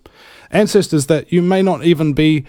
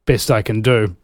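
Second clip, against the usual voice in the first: ordinary delivery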